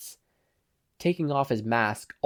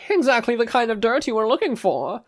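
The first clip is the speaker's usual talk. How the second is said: put-on high, loud French-accented spy; 11 semitones higher, 6 dB louder than usual. A narrator's reading. Spy reports to his boss in a high French accent. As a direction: play the French spy, pitched up. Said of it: "the spy replied in a French accent".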